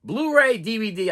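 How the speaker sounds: ordinary delivery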